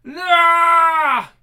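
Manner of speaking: loud, high cry of pain; agonized scream. Acted. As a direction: let out a dying scream. Dying scream.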